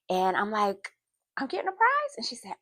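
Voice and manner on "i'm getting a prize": put-on high-pitched, incredulous child asking back; surprised question. Child questions her teacher about a prize, surprised.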